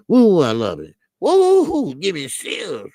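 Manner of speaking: loud, high whoops of delight; excited whoops. Real, not acted. Host gushing over a Georgia accent, loud high whoops. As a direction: let out a thrilled woo.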